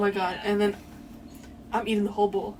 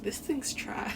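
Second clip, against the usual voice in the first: unvoiced, softer dismissive whisper; whispered verdict; 4 semitones higher, 8 dB quieter, much slower than usual. Retelling her verdict on her parents' soup, whispered. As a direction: whisper it like a secret judgment. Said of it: "but I was like".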